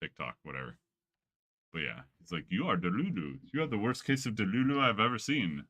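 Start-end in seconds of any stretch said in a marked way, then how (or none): 2.5-5.6 put-on a light African accent, scolding; mock the girl in a hint of an African accent; African accent impression. Reaction guy scolding the girl, light African accent. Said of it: "the African accent".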